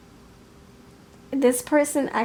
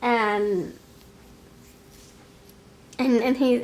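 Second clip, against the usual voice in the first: usual speaking voice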